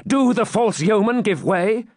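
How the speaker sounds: high, urgent question; anxious demand. Acted. Knight fears the yeomen retreat, urgent. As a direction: demand the news indignantly.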